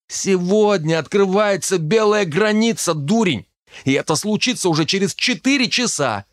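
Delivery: high, emphatic, exasperated reminder; excited announcement. Acted. Дарт взбудораженно напоминает о событии. (возбуждённо, высоким голосом)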